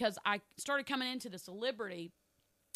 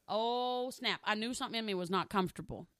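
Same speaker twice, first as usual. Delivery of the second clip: ordinary talk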